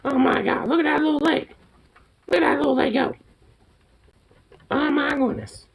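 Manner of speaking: high cooing pet talk. Real, not acted. Cooing over pet's leg.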